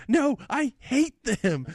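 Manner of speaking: high, drawn-out mock tantrum; imitated screaming kid. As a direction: stretch each word like a furious little boy. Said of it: "he's just screaming out".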